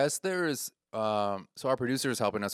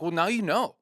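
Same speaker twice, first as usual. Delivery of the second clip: higher, louder imitated reproach; upset retort; 8 semitones higher, 5 dB louder than usual. Comedian answering the heckler's excuse, imitated upset. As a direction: throw it back, acting hurt. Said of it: "my friend gets upset".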